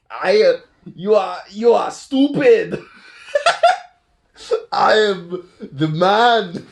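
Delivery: slow, high, hammed-up villain voice; Red Skull. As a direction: drag out each word like a pompous cartoon villain. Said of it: "so he's like".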